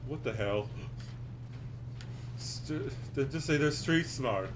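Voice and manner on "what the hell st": normal talking voice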